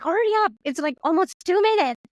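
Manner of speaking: high, hurried impatient nagging; impatient husband. Retold or imitated. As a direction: rush her along, mock impatient. Wife quoting husband rushing her.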